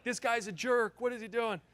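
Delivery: high, huffy crowd mutter mimicked; angry crowd. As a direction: play the annoyed audience grumbling. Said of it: "The whole place was like".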